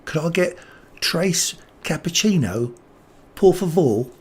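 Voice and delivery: Spanish said in a mock English accent; imitating mom's English-accented Spanish; lightly English-accented tourist Spanish. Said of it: "she'd do it in an English accent".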